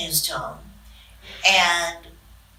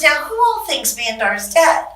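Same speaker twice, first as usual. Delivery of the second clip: higher, lively Bush quote; Bush voiced; 8 semitones higher, 5 dB louder than usual. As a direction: play the boss polling the room. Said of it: "He says".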